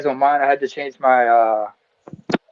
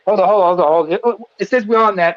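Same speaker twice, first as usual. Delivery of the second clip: normal talking voice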